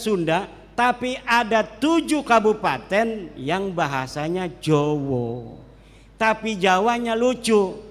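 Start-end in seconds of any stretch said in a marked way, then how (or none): 4.6-5.6 "Jawa" said as "Jowo", low Javanese o vowels; Javanese pronunciation. Shown as a demonstration. ("Jawa" diucapkan "Jowo" ala Jawa)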